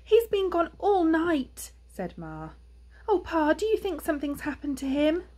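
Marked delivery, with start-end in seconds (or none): 0.0-1.7 high, loud, drawn-out fretting; worried mother hedgehog. A narrator's reading. Ma fears for missing son. 3.1-5.2 anxious, high-pitched wife; worried mother hedgehog. A narrator's reading. Ma fears for missing son.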